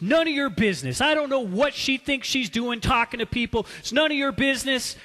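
loud, high and fast angry outburst; furious retort. Retold or imitated. Husband shouting at his friend to butt out, furious. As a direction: snap it back at him in fury. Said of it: "Ted in his fury".

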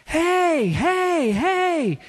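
high, loud calling out; screaming kids. Retold or imitated. Little kids at a football game calling to their hero, excited shouts. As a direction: yell to get your hero's attention. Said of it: "they start screaming at him".

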